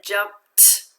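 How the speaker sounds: "jumped" with a crisp, separated t at the end; unvoiced ending. Shown as a demonstration. Teacher shows students the ED sound, "jumped" ending in unvoiced t. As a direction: release the final t of "jumped" without voice. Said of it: "I made the t sound".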